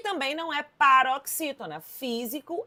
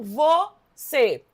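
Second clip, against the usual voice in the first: "você" split slowly as vo, cê; syllable by syllable; 3 semitones higher, 5 dB louder than usual. Shown as a demonstration. Teacher proving the word has two syllables, "você" split slowly. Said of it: "de sílaba".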